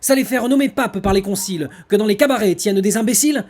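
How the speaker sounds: loud, high, grand theatrical declamation; hammy stage actor. Acted. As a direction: boom it out like a hammy tragedian. Declaims the non-merci tirade.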